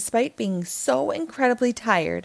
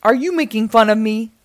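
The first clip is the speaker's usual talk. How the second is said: offended giant; 8 dB louder, faster than usual. Acted. Giant offended by Jack's rhyme.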